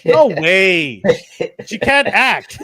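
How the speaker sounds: loud, high-pitched outright refusal; emphatic dismissal. Real, not acted. Co-host rejecting Brie Larson for the role, emphatic dismissal. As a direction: shoot the idea down loudly, voice jumping up.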